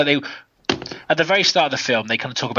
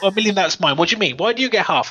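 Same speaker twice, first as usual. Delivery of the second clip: mock bickering; 7 semitones higher, faster than usual. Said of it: "He's like".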